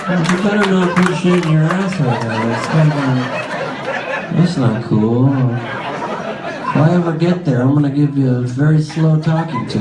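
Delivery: half-hearted mock objection; lethargic protest. Lethargic boyfriend feebly defending his girl, mock-protesting. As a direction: object with no real urgency, dragging the threat out. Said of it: "He would lethargically try to stop it".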